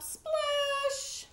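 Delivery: high, drawn-out "splash"; playful sound effect, held high; storybook splash. A narrator's reading. Sound word for frog jumping in.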